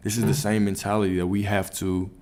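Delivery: usual speaking voice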